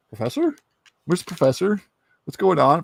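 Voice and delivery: high, questioning, mounting alarm; worried calling out. Acted. Scott waking in the professor's empty wheelchair, worried calling out.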